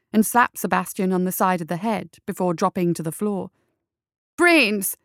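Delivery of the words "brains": a sudden loud, higher blurt of brains; panicked improvised shout. A narrator's reading. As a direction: shout it out to cover a blunder. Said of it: "McMorning shouted".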